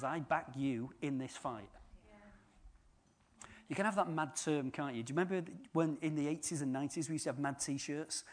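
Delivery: ordinary delivery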